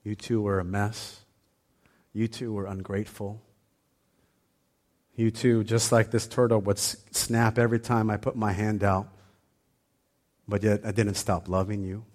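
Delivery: hushed and tender, speaking to a stubborn son; the Father's voice. Retold or imitated. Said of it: "And the Father was like".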